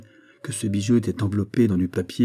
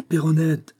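high, louder anxious call; 8 semitones higher, slower than usual. A narrator's reading. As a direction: throw the name out toward the garden. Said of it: "en criant toujours".

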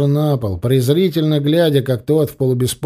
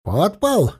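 exclaiming; 6 semitones higher than usual. A narrator's reading. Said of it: "воскликнул Учай".

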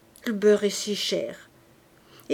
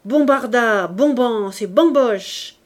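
loud, slow chant of names; cried; 7 dB louder, slower than usual. A narrator's reading. Listolier crying out against Tholomyès, loud. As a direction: bellow each name. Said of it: "cria Listolier".

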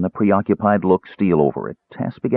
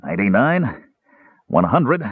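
counting through exertion; puffing. A narrator's reading. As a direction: puff out the last counts of exercise. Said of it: "puffed the big man".

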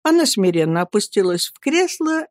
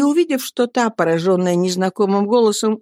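ordinary delivery, nothing marked

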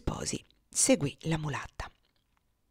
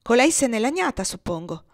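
stern; 6 semitones higher, 6 dB louder than usual. A narrator's reading. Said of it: "con piglio severo".

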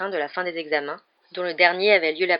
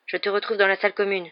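ordinary delivery

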